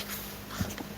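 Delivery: barely audible whispered curse; acted-out stunned whisper; whispered dismay. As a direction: let it out voicelessly under your breath. Retold dismay, mouthed under breath.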